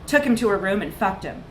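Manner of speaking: ordinary delivery, nothing marked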